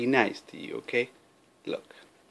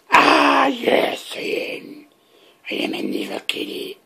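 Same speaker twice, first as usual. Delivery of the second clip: sinister whispered villain reply; evil kitty voice; 12 semitones higher, 6 dB louder than usual. Pretend. Owner voicing the cat's answer as a sinister whisper. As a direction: breathe it out like a cartoon villain cat.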